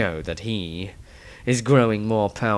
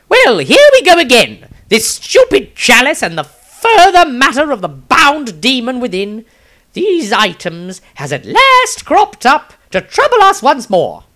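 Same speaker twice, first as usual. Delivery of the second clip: much higher, much louder exasperated character voice; hammed-up high, loud complaint; Maestro's loud voice; 12 dB louder than usual. Maestro griping loudly about the cursed chalice. Maestro's diary entry, shouted.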